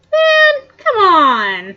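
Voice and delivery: loud, high whiny cry of protest; frustrated outcry. Real, not acted. Gamer yelling at the game for blocking the weapon, frustrated outcry. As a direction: yell at the game for saying no.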